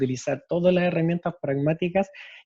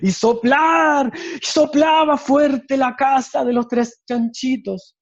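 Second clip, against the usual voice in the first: much louder and higher, dragging out the blowing; loud climax; 8 semitones higher, 6 dB louder than usual. Shown as a demonstration. Teacher showing volume at the story's climax, loud and drawn out. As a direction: boom out the wolf's huffing and puffing. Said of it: "mi volumen tiene que ser coherente".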